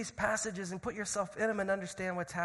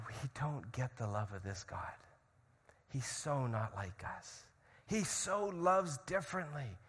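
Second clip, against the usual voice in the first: soft, slow, mostly low; hushed awe; 3 semitones lower, 6 dB quieter, slower than usual. Retold or imitated. Moi listeners stunned by how God loves, hushed awe. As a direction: say it slowly in quiet wonder. Moi awed by God's love.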